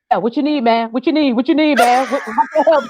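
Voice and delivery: tough-guy pastor. Retold or imitated. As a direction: lightly play the husband turning gangster. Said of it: "here goes Pastor Pratt".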